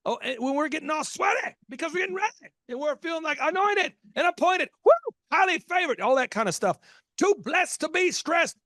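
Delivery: high, fervent mock preacher fervor; hyped church voice. Host mocking overexcited churchgoers in a high preachy voice. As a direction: ham up the anointed worship excitement.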